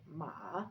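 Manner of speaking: "ma" dipping and rising; third tone. Shown as a demonstration. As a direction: drop "ma" low, then lift it. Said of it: "it goes down and up".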